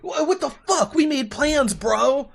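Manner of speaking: high, loud mock outrage at a friend; outraged yell. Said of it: "She's like".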